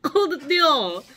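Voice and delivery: high laughing sour-face squeal; eating sour candy. Real, not acted. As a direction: squeal through the sour bite. Sour taste reaction.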